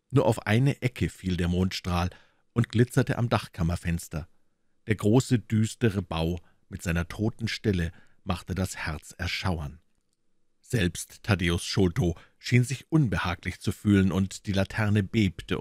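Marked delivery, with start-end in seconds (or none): none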